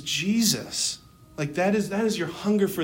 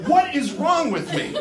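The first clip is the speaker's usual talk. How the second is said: higher, slow, incredulous complaint; exasperated self-reproach; 6 semitones higher, 4 dB louder than usual. Retold or imitated. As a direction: act out despairing at yourself, comically. Retold exasperated complaint to director.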